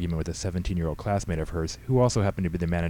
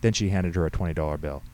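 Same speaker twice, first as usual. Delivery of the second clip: ordinary delivery, nothing marked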